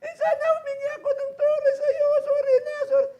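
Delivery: high, pleading mock wail; hammed-up ghost begging sorry; voice of the dead. Voicing the dead in cemetery. (nagmamakaawang boses ng patay)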